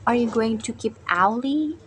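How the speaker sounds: ordinary talk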